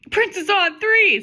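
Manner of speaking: high, loud, drawn-out rallying call; mock-heroic huddle shout; team huddle cheer. A narrator's reading. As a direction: call it out like a hammed-up team chant. Team huddle cheer voiced in the story.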